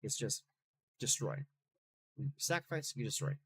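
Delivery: ordinary delivery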